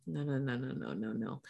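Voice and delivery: very soft, low string of refusals; muttered refusal. Real, not acted. Rejecting the paddleboard idea, muttered refusal. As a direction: say it low under your breath. Rejecting the camp, under her breath.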